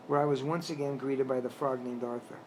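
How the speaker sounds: ordinary delivery, nothing marked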